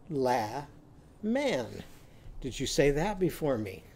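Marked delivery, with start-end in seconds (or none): 0.1-1.8 "La man" in two separated syllables, both with the a of cat; changed vowels. Shown as a demonstration. Teacher modelling lemon with Annie's sound, "La man" split with short a. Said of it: "what are we going to call it?".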